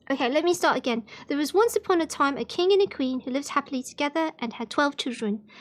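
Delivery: ordinary delivery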